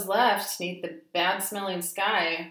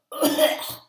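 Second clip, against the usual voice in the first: cough; 9 semitones higher, 7 dB louder than usual. A narrator's reading. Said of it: "please pardon my cough".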